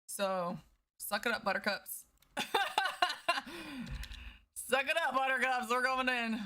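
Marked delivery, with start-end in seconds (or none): 2.3-4.3 a bright laugh up high; high playful laugh. Real, not acted. Streamer laughs at own tease to chat. 4.5-6.4 playful sing-song tease. Acted. Teasing chat after laughing.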